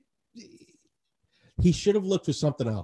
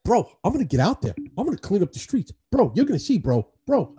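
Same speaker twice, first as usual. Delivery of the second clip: lightly voiced frat bro, higher; 5 semitones higher than usual. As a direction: hype bro swagger, a touch higher. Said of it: "frat guy energy".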